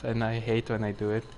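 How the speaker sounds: ordinary delivery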